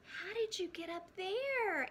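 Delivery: high, loud surprised question. Acted. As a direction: ask up in wonder. Voices Little Frog asking.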